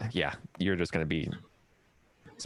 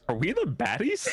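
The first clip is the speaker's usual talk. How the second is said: high mock worried; 14 semitones higher than usual. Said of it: "comedy sketch quote".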